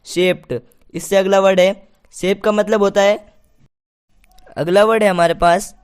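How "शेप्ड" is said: शेप्ड with a T ending, "shept"; correct pronunciation. Shown as a demonstration. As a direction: demonstrate शेप्ड ending on ट, not ड. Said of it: "क्या होता है?". (शेप्ड के अंत में साफ़ ट)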